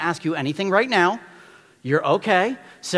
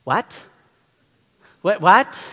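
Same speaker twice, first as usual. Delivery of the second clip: rising, louder baffled what, what; mock surprised parent; 4 semitones higher, 8 dB louder, much slower than usual. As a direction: play the stunned parent. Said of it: "the reaction ... is gonna be".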